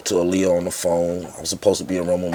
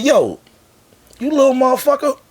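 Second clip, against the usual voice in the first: much higher, louder mocking mogul voice; incredulous boss; 8 dB louder than usual. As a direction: look him up and down in disbelief. Boss addressing young rapper.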